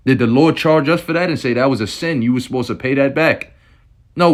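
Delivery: high-pitched, heated sermon in full spirit; preaching in the spirit. Real, not acted. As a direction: rail at the elites with zeal. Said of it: "I'm speaking in the spirit right now".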